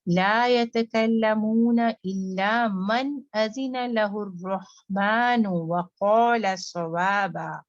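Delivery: tajweed chanting; Quranic recitation. Shown as a demonstration. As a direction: recite in a melodic Quranic tune. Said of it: "व क़ा heavy".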